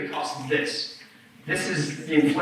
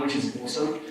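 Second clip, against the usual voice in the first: dry, understated sarcasm; sarcastic; 4 semitones lower, 3 dB quieter, faster than usual. Real, not acted. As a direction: slip it in as a deadpan sarcastic aside. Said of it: "That was sarcasm".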